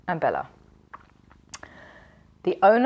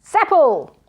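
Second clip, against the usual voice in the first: loud, higher call of the name; cried out; 7 semitones higher, 7 dB louder than usual. A narrator's reading. Magician calling his missing servant, loud cry. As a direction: call again, more impatient. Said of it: "cried Zuckerman".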